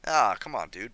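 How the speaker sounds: loud exasperated groan at himself; frustrated outburst. Real, not acted. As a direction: blurt it out, fed up. Frustrated at own mistake.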